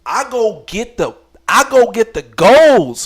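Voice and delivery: loud, slow, hyped-up brag. Retold or imitated. Triumphant, rushing for condoms.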